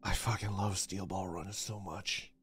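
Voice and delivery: very soft, low lament; quiet, heartbroken fan; crestfallen. Real, not acted. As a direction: murmur it low and defeated. Mourning the fake announcement.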